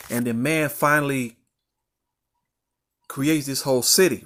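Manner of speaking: ordinary talk, nothing marked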